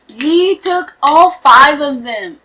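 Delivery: slow, loud, stunned; shocked. Real, not acted. Reacting to the kidnapping, loud stunned disbelief. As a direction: drag it out in disbelief.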